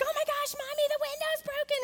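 high, childlike imitation of a shocked daughter; shocked little girl. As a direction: squeak it out like a stunned kid. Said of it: "And Bella's like".

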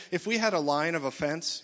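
ordinary delivery

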